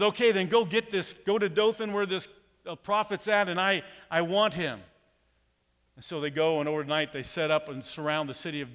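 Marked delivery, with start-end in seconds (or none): 0.0-4.8 louder, higher order to the troops; commanding king. Retold or imitated. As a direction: bark out the order like a king sending soldiers. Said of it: "And he says".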